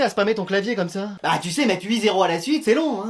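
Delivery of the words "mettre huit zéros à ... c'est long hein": ordinary talk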